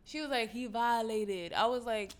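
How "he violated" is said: ordinary talk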